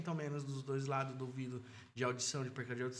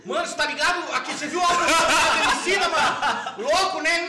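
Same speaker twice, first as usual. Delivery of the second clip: laughing; 14 semitones higher, 13 dB louder than usual. Real, not acted. Laughing while joking about old days.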